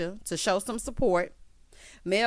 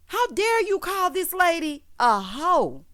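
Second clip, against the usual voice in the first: high, slow, emphatic rebuke; outraged scolding; 9 semitones higher, 4 dB louder, slower than usual. Real, not acted. Host rebuking husband for insulting this lady, slow, high and outraged. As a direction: draw out each word in indignation.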